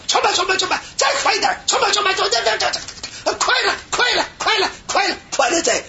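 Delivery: very high, frantic mock chant. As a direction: egg the chanter on in a high pitch. (模仿急促高声催喊)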